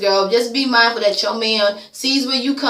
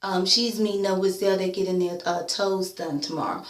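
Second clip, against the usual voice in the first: soft, low mock gossip voice, dropping quieter; lowered, hushed imitation of a tattletale; mocking Moniece; 4 semitones lower, 5 dB quieter than usual. Mimicking Moniece spilling gossip, low and hushed. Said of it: "to sit up here and say".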